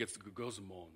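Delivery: guzomon said low and murmured; guzomon, Greek for grumbling, low and muttered; Greek word guzomon. Shown as a demonstration. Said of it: "if you say it within the Greek".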